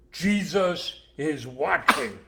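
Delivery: slow, loud, drawn-out eerie voice; each word stretched like a hidden voice; parrot voice. Acted. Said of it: "when he heard it again".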